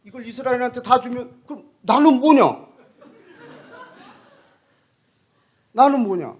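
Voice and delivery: loud, high, aggrieved demand; indignant cry. Retold or imitated. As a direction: cry out the question in frustration. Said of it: "이 답을 그 친구한테 줬어요". (억울하게 소리치듯)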